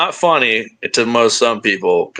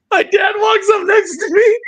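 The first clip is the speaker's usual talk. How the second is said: barely holding it together with laughter; laughing shriek. Real, not acted. Laughing while telling the story.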